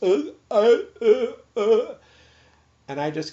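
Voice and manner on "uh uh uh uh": loud, high strangled "uh" sounds; choked grunts instead of a first word; tongue-tied grunting. Shown as a demonstration. Patient unable to start a sentence, grunting. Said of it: "first words out of my mouth were just".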